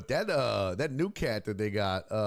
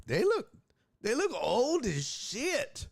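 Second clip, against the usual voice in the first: surprised; slower than usual. Real, not acted. Incredulous exclaim, high pitch.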